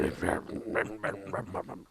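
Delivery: low, soft wordless mumbling; Nick Nolte. Acted. Podcaster mimics the actor's voice, marble-mouthed mumble. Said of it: "chewing a bunch of marbles".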